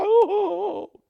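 high, loud fake fretting "oh, oh"; mock panicked wail.

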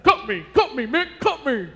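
loud, slurred bad Rocky voice; a punch-drunk boxer begging; hammed-up Rocky pleading with his trainer; terrible Rocky. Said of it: "I do a terrible Rocky impersonation".

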